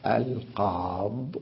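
"القاض" drawn out and ending on the dad, no ya; pausal form. Shown as a demonstration. Teacher demonstrating the pause slowly and softly. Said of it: "ويقول".

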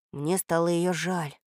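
usual speaking voice

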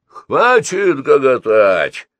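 loud, high, drawn-out order; bellowing. A narrator's reading. Gorilla-like leader ordering his men to arm, bellowing. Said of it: "заорал горилла".

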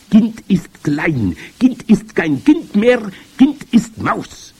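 high, loud witch announcing each step; commanding high witch voice; grand witch. A narrator's reading. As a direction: relish each step of the transformation, deliberately. Grand witch describes the transformation.

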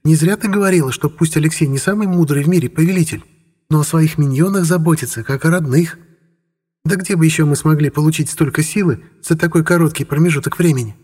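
high squeaky little voice. A narrator's reading. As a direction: chirp it out, bright and tiny. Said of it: "пропищала Эльфи".

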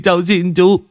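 high begging whine; pleading. Acted. Boy begging Vũ, high whine. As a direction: beg for mercy.

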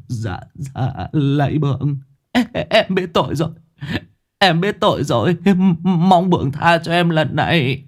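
high, fast, trembling plea; stammering terrified worker. A narrator's reading. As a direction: beg for mercy, stumbling over words. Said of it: "giọng run rẩy, lắp bắp".

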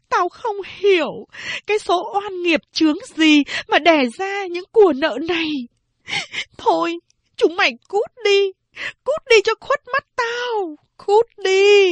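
very high, sobbing outcry rising to a shout; sobbing lament. A narrator's reading. Weeping mother telling her daughters to get out. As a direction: cry out and drive them away. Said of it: "Mẹ vừa khóc, vừa nức nở nói".

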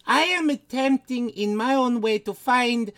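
put-on high, hammy Eastern European accent; Lady Bathory accent. Lady Bathory pitching green living to Stephen, Eastern European accent. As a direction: make an earnest case in a thick put-on Eastern European accent. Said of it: "people said they liked your accents".

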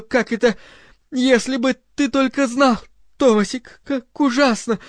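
high, tearful pleading; sobbing. A narrator's reading. Linda excusing herself to her lover, sobbing. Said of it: "зарыдала".

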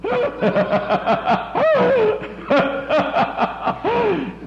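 high ringing burst of laughter; big high laugh from the pulpit; hearty laugh. Real, not acted. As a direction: laugh out at your own joke. Preacher laughs at his own joke.

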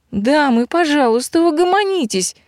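high-pitched polite plea, rising; pleading peacemaker. A narrator's reading. Witch's daughter calming two quarrelling women, pleading. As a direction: sing out a high, ladylike appeal.

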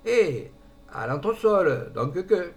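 put-on southern French accent. A narrator's reading. Concierge calls out cheerfully in a southern French accent. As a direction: call up in a jolly southern French lilt. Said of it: "Une voix du midi, joyeuse, résonna".

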